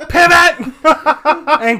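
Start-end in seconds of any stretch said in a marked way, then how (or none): 0.1-0.7 shouted Pivot. Acted. Friends pivot shout joke.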